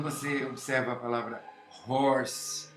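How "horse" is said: horse with a breathy aspirated h at the start; aspirated English h. Shown as a demonstration. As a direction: sound the English h in horse from the throat. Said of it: "o H tem aquilo que se chama som aspirado". (horse com h aspirado do inglês)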